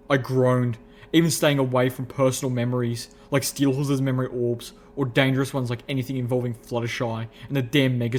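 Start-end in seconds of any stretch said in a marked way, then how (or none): none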